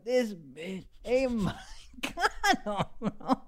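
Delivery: drawn-out shock with a laugh; mock outrage, slow; slow disbelieving retold line. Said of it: "I go".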